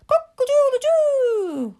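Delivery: high, loud, long-held cock-a-doodle-doo; showy cockerel cry, last note stretched; smug rooster crow. A narrator's reading. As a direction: crow with pride like a self-satisfied cockerel. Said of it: "of smug self-congratulation".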